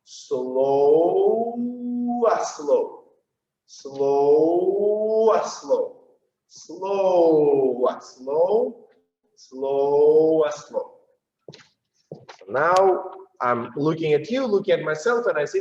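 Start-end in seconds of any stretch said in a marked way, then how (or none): 0.0-10.9 long SLOW, then a quick "ah, slow"; drawn-out count. Shown as a demonstration. Dance teacher counting out the step, drawn-out then quick. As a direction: stretch the first SLOW, follow straight on with "ah, slow". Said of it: "an immediate reference to slow".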